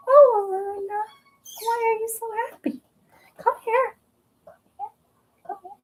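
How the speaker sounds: talking sweetly to her cat Luna; cooing pet talk. Real, not acted.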